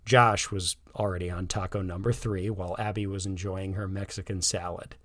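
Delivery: plain reading voice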